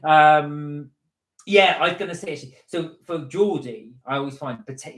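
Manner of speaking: normal talking voice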